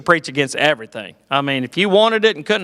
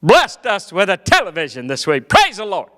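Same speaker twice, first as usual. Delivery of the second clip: preacher dad; 8 semitones higher than usual. Retold or imitated. Preacher dad announcing the new television, high. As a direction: proclaim it from the pulpit. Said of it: "finally he weighed in".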